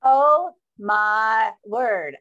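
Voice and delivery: a loud, slow, stunned oh my word; amazed. Real, not acted. Moderator stunned after the speaker's closing words, loud and slow. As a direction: let the awe burst out slowly and loud. Awed reaction to the speech.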